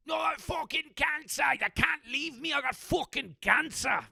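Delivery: high, loud hammed-up English accent. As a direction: shout it in an exaggerated English accent. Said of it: "I'm really good at accents".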